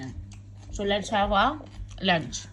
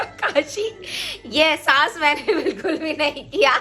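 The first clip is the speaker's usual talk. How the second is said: high, laughing through the words; teasing confession, half laughing; laughing inner thought; 7 semitones higher than usual. Acted. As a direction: giggle out the secret thought.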